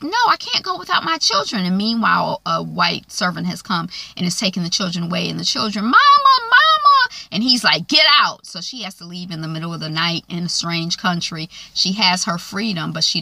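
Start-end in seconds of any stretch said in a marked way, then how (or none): 0.0-1.6 high, pleading cry; begging not to lose her kids; desperate plea. Retold or imitated. Harriet begs the son to let her keep her children, high and desperate. 5.8-7.1 put-on high, loud cry of a child; child's scream. Children scream for their mother as they are taken away, high and loud. 7.9-8.5 loud, high bark; angry order. Retold or imitated. Benjamin orders Harriet out of the house, loud and angry. Son throws the woman out.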